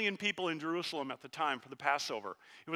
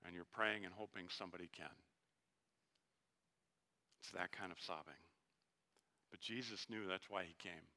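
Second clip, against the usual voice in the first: tender sermon hush; 6 semitones lower, 9 dB quieter than usual. Acted. Preacher telling the congregation of Jesus' tears, hushed and tender. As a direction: draw them close and speak gently. Tender quiet passage on weeping.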